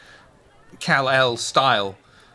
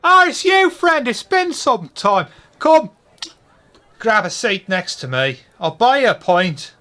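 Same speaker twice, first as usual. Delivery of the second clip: high, loud cheery barfly; Irish pub regular; 9 semitones higher, 8 dB louder than usual. Acted. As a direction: hail an old friend warmly. GM voices friend in flat cap.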